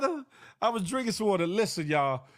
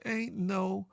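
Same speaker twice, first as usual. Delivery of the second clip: slow drawn-out disbelief; 3 semitones higher, much slower than usual. Retold or imitated. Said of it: "'Cause I said".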